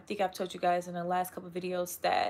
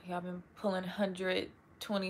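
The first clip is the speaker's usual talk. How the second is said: normal talking voice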